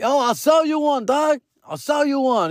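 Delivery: high-pitched call across a distance; acted holler without real force. Dad offering the departing fan a bobblehead, calling out.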